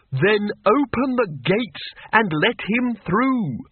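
high, slow commanding shout; desperate shout. Acted. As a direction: throw it out to the guards. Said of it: "and shouted".

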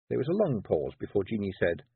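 ordinary delivery, nothing marked

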